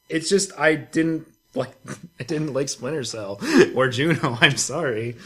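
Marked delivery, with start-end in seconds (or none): none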